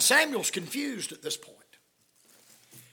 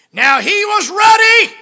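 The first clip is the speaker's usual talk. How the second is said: very loud, high, slow cry; preaching at full force; 12 semitones higher, 15 dB louder, slower than usual. Acted. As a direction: shout it slowly to the church. Preached scripture line.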